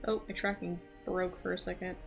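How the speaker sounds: ordinary delivery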